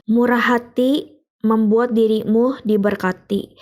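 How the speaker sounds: normal talking voice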